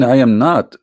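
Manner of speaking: usual speaking voice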